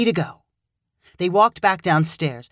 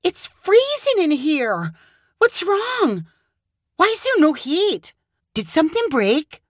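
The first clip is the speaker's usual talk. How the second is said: much higher, slow, alarmed questions; shocked cry; 11 semitones higher than usual. Acted. Hannah stepping into the icy apartment, shocked cry. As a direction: gasp out the shock of the cold.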